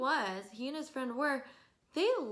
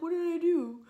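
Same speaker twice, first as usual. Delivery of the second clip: mock clueless guy; 5 semitones higher than usual. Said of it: "He's like".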